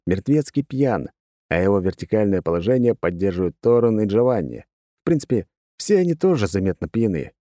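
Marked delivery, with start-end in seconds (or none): none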